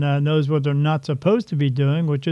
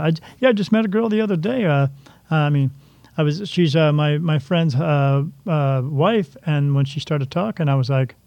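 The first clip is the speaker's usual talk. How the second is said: usual speaking voice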